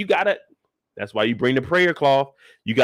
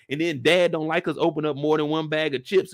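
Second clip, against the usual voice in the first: ordinary delivery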